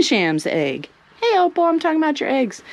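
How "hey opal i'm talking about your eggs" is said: higher, quick chatter to the hen; bright sing-song aside; pet talk. Real, not acted. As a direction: tease the chicken up high. Talking to a hen.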